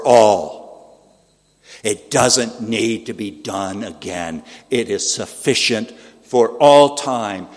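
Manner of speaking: loud, slow, hammered-out proclamation; ringing pulpit climax; emphatic preaching. Real, not acted. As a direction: preach it with force, leaning hard on key words.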